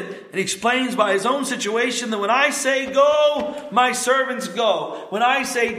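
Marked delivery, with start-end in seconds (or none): 2.9-3.7 barked command. Acted. Centurion commanding his servants.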